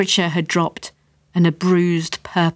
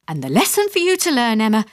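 ordinary delivery

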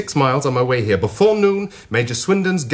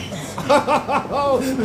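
defiant laugh; 10 semitones higher than usual. Acted. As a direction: throw your head back and laugh.

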